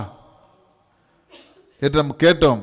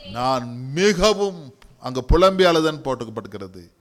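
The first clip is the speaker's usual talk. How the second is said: normal talking voice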